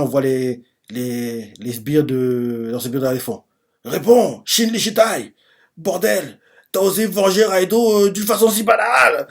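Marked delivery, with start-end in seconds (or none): 3.9-9.3 high-pitched acted indignation; irate mock protest, pitched up; angry henchmen. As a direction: demand it, rising in outrage. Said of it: "On voit qu'ils sont énervés".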